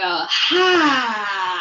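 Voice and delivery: loud breathy roar with tongue out; lion's breath roar. Shown as a demonstration. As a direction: let out a big open-mouthed roar. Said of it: "I'm gonna take a big breath in".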